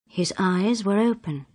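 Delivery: plain reading voice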